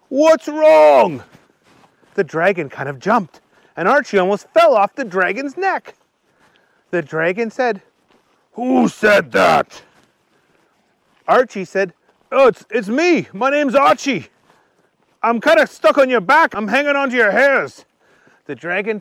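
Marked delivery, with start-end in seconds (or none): 0.1-1.2 high, loud character yell. A narrator's reading. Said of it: "and yelled". 8.5-9.8 slow, drawn-out dragon voice; startled dragon. A narrator's reading. 12.3-17.9 high, hurried character voice; nervous Archie. A narrator's reading. Voices Archie, stuck on dragon.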